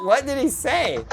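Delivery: laughing helplessly, high and giggly; can't stop giggling while asking; kind gentle laugh. Real, not acted. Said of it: "I'm not laughing at you".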